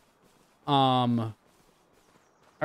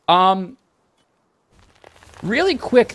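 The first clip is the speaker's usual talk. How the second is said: normal talking voice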